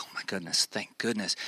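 hushed, whispery retold relief; relieved parents. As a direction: breathe it out low and soft, relieved. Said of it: "this sense of relief".

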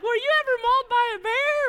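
high, fast mocking sister voice; high mock voice of a nervous sister; sister's voice. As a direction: squeak it out like the kid sister. Sister's voice in retold story.

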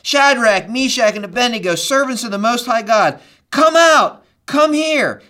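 calling loudly into the furnace; king shouting. Retold or imitated. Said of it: "and shouted".

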